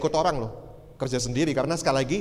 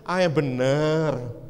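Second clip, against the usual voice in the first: slow, drawn-out sceptical challenge; teasing disbelief; much slower than usual. Retold or imitated. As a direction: stretch the words in doubt. Doubting the claim once more.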